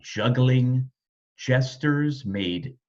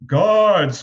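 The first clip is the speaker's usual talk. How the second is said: louder call for the guards; 6 semitones higher, 9 dB louder than usual. A narrator's reading. King ordering guards, calling out loud. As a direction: summon the guards. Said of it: "called the king".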